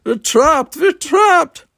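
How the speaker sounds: very high, loud screams rising; hysterical screaming. A narrator's reading. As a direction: scream it in blind panic. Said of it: "he was screaming".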